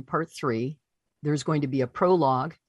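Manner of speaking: normal talking voice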